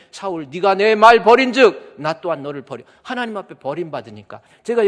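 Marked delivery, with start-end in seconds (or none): 0.1-2.8 voice of God. Retold or imitated.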